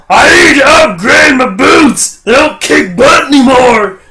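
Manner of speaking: high, slow bellow; really loud old man. Retold or imitated. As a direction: shout it as a cranky customer would. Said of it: "He's talking really loud".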